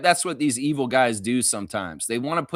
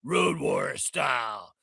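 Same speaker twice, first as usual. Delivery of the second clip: Hawk impression; much slower than usual. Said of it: "Like Hawk".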